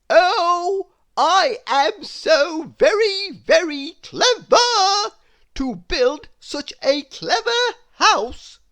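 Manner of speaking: high, loud, slow smug boast; a very pleased little man crowing about himself; boastful Mister Clever. Acted. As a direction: puff yourself up, drawing out every word.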